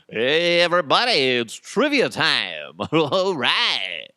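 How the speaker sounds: loud, high, slow hammed-up host; game show host voice. Host greets everybody loudly to open trivia time. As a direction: belt it like a cheesy game show opener. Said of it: "I'm not gonna do the whole voice like that".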